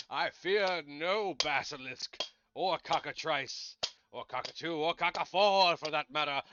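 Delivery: bold boast. Acted. Knight's boast to the monster while crowing.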